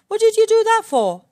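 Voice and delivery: a trapped lion squealing in protest; outraged Lion. Acted.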